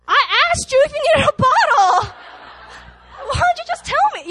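very high, comic indignant protest; squeaky-high, laughing exasperated complaint; mock exasperation. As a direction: scold a baby in playful outrage. Mock scolding of the baby.

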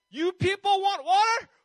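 high, drawn-out furious shout; exasperated. Acted. Moses losing patience with the Israelites, exasperated shout. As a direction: bellow it at the crowd, fed up. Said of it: "He's, like, getting so annoyed".

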